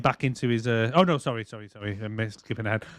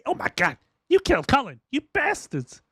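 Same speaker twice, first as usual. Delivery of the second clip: mock outrage; 11 semitones higher than usual. Mock outrage at Colin's death.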